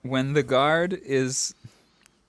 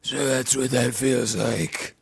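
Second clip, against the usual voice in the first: slow, gravel-voiced Batman line; slower than usual. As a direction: drag it out in a dark gritty growl. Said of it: "His voice wasn't that bad".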